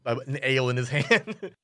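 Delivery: normal talking voice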